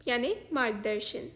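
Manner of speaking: normal talking voice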